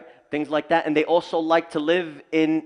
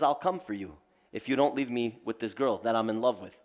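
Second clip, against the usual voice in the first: much lower and softer, a looming threat; sinister jinn threat; 6 semitones lower, 8 dB quieter than usual. Retold or imitated. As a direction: drop low and quiet like a lurking jinn.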